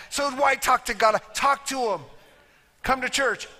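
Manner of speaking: ordinary delivery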